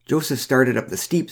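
ordinary delivery, nothing marked

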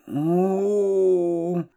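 acted, high, held "ooh"; pained "oh". A narrator's reading. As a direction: groan as if you'd stubbed a toe. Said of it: "looked like she'd stubbed her toe".

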